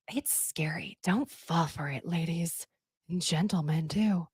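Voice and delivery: soft, breathy whisper to the audience; hushed warning. Acted. Warning the viewers about men, in a whisper. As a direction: lean in and warn them in a hush.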